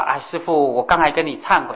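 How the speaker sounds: ordinary delivery, nothing marked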